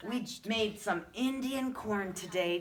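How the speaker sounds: ordinary delivery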